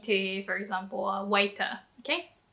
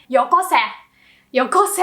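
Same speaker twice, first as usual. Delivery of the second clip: loud, raised-pitch command; rude demand; 7 dB louder, faster than usual. Shown as a demonstration. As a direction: order them rudely to hand it over. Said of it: "if you wanna sound rude".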